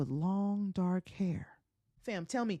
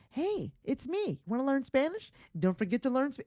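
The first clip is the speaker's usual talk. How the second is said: high-pitched pestering app; high, needy mock notification; 7 semitones higher than usual. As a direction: pipe up high like a clingy reminder.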